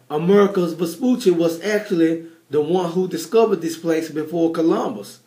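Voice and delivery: ordinary delivery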